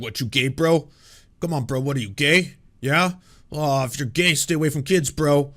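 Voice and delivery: loud mocking bro voice. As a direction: taunt like a playground bully. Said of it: "Being like".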